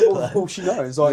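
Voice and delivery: laughing. Real, not acted. Laughing while telling it.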